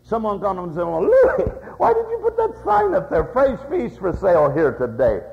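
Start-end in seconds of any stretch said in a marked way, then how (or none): 0.8-3.2 pitched way up and louder, baffled; incredulous passerby. Retold or imitated. A passerby puzzling at Louis's sign, high and incredulous. 3.2-5.2 reading the sign back through a laugh; mocking laugh. Passerby laughing at the old fish sign, mocking. Passerby mocks the sign's wording.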